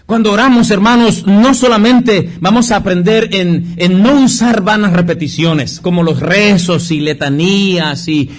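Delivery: predicación más aguda y fuerte. Acted. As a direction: proclama con fervor a los hermanos. Predica con fuerza. (el pastor predica con fervor a la congregación)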